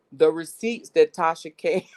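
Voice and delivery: real loud burst of laughter mid-sentence; cracking up. Real, not acted. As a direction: break into laughter as you finish. Cracks up over the receipts story.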